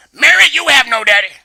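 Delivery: loud, high disbelieving yell; outraged shout. Acted. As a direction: explode at the kid. Mom shouts back.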